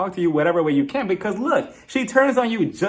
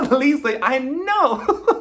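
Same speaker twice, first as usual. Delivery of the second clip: high, drawn-out KNOW broken by laughter; laughing through a stretched, high-pitched retort; cracking up mid-line; 9 semitones higher, much slower than usual. Acted. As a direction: try the mock outcry but lose it laughing. Cracks up mid-impression, restarts the line.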